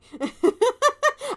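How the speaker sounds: high giggling burst; laugh. Real, not acted. Reader interrupted by amusement, laughing. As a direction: giggle mid-sentence.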